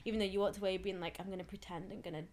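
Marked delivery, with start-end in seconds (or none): 1.2-2.2 mock coy. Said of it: "I'm so coy".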